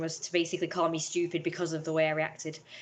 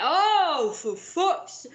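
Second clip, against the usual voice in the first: high, loud mock exasperated cry; exasperated outburst; 10 semitones higher, 7 dB louder, much slower than usual. Mum snapping at daughter, loud mock exasperation. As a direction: throw up your hands at the daughter. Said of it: "She was like".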